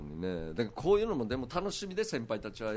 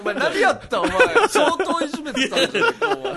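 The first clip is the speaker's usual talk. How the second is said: high, loud, through real laughter; loud incredulous laugh-talk; laughing accusation; 12 dB louder than usual. Real, not acted. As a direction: accuse him while cracking up. Laughing accusation after the punchline.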